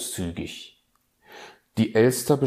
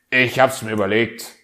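growled; 3 semitones higher, 8 dB louder, faster than usual. Acted. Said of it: "knurrte der Fuchs".

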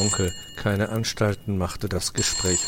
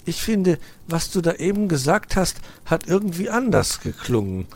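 contradicting; 10 semitones higher, 4 dB louder than usual. Acted. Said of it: "widersprach Weißohr".

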